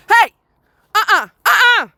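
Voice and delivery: high, loud uh-uh at a dog; sharp warning to dog. Real, not acted. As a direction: stop the dog in its tracks. Scolding the dog.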